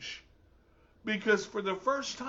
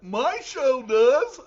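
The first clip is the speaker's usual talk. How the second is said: loud, drawn-out boast with raised pitch; hammed-up triumphant retort; mock defiant comeback; 4 semitones higher, 9 dB louder, much slower than usual. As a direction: throw it back at the doubters, big and slow. Mock comeback to imagined objector.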